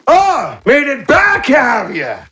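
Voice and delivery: high-pitched shout down to intruders; calling from upstairs. Acted. Someone upstairs calling to returning intruders, high shout. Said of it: "you hear from upstairs".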